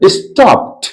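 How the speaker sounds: स्टॉप्ड as "stopt", released T at the end; final -ed as T. Shown as a demonstration. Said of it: "ठ आवाज आनी चाहिए".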